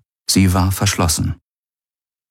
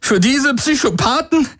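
voice derailing; 12 semitones higher than usual. A narrator's reading. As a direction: let the voice climb until it breaks. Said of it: "Die Stimme entgleiste ihm".

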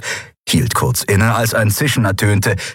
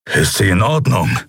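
gruff grumble. A narrator's reading. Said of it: "brummte er".

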